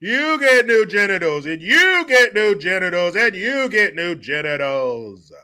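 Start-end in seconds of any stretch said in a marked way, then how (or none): none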